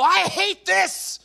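put-on high, drawn-out kid tantrum; frustrated yell. Boy fed up with his spinning top, frustrated yell. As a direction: blurt it out in mock childish fury. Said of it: "anger and frustration starts to mount up".

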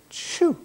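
sudden high shoo like scattering hens; high yelping shoo; shooing chickens. Retold or imitated. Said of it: "he went".

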